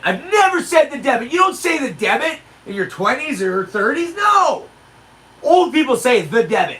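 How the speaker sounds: high-pitched outburst building to a shouted no; hammed-up incredulous protest, climbing high; mock disbelief. Man talking to himself at the till, mock astonished outburst.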